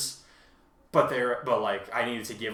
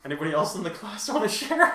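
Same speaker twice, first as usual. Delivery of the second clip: lightly voiced teacher asking the class, higher and louder; mock teacher; 8 semitones higher, 5 dB louder than usual. Host jokingly addresses listeners as a class, mock teacher voice. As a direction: play-act a teacher calling on the class.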